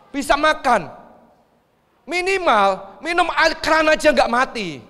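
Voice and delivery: high, loud, emphatic disbelief; loud incredulous protest. Real, not acted. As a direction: raise your voice in disbelief at the claim. Preacher incredulous at reported starvation. (seruan lantang tak percaya)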